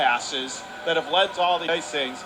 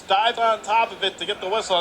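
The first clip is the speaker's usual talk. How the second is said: normal talking voice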